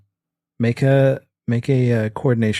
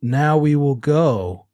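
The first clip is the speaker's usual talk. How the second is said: slow, stilted delivery; halting, over-deliberate fake line; bad acting; slower than usual. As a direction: play along like a hopeless actor. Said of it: "'cause Gary does not".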